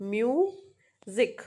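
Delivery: music split as myu, pause, zik; two vowel sounds. Shown as a demonstration. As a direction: draw out music in two separate syllables. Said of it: "has two vowel sound".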